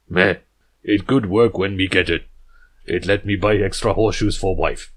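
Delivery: chest-thumping jungle hero, terse and loud; jungle stallion talk. Acted. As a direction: puff up and speak in blunt caveman words. Balrus shrugs it off.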